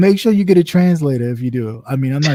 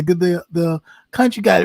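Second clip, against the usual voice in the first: ordinary talk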